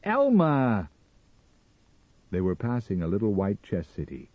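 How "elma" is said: high, loud yell of her name; frustrated rage. A narrator's reading. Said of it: "in his frustration, in his rage".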